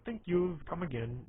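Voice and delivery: lightly imitated Indian accent; Indian accent, Simpsons shopkeeper. Suggesting a Simpsons impression to listeners, light Indian accent. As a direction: put on a mild cartoon Indian accent. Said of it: "talk like Habib".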